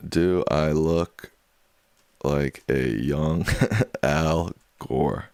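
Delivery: very slow, stretched words; slow drawn-out question. Pretend. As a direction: drag out the poll question. Reading poll question drawn out.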